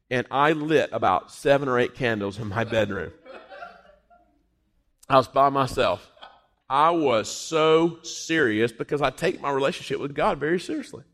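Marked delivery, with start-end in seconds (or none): none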